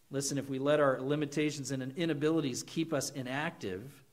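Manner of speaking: ordinary talk, nothing marked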